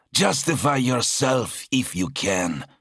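high-pitched demand; challenging. Acted. Zal challenges his brother to justify himself, demanding.

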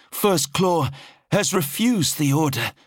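high-pitched subordinate's report; high, strained-up servant voice; anxious officer reporting. A narrator's reading. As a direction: report to a feared master, voice pitched right up. Officer relays the refusal.

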